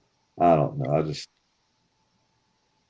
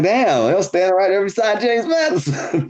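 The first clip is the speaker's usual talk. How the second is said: much higher, astonished retelling; amazed realization; 11 semitones higher than usual. Retold or imitated. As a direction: marvel at missing it. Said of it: "I'm like".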